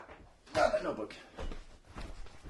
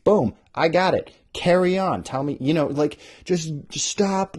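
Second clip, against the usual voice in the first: higher, louder exasperated rant; 7 semitones higher, 6 dB louder than usual. Real, not acted. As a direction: rant like you're fed up. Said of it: "ranting about descriptions".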